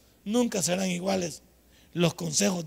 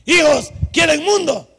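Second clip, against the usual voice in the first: loud, slow raised shout; calling out; 7 semitones higher, 9 dB louder, slower than usual. Acted. Parent challenging children who want the world, loud slow shout. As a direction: shout the question out, drawn out and high.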